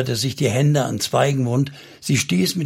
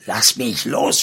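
understated shout to be released; angry cry; 2 semitones lower, slower than usual. A narrator's reading. Salmon raises his hand to strike Noah, restrained angry cry. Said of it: "schrie er abermals".

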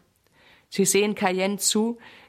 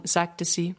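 usual speaking voice

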